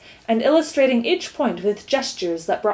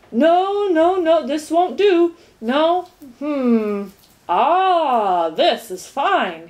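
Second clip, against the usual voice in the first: higher, louder searching, then slow satisfied find; mumbling, rummaging gateman; 6 semitones higher, 4 dB louder, much slower than usual. Acted. Gateman digging through his suitcase, muttering. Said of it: "mumbling to himself".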